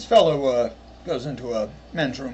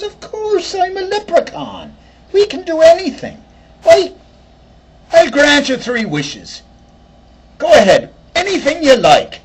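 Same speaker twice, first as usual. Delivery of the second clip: high, loud Irish lilt; Irish leprechaun; 13 semitones higher, 8 dB louder than usual. Retold or imitated. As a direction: play the cheeky little leprechaun, pitched up high.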